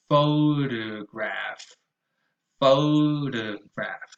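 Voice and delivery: "photograph" slowly, middle o reduced to schwa; slow schwa demo. Shown as a demonstration. Teacher modelling photograph, slowly. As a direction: draw out "photograph" with a lazy middle vowel. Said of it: "So not photograph".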